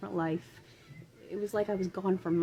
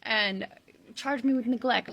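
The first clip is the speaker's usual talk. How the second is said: ordinary delivery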